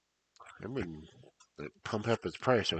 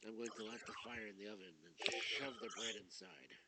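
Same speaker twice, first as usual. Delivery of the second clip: much softer, changed voice; soft echo; 3 semitones higher, 17 dB quieter than usual. Acted. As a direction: repeat it softly in another voice. Same words, soft, changed timbre.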